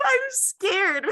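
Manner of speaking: high, laughing mock fright; mock scared. Retelling the text she sent her husband, mock scared. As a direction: squeak it out in pretend fear.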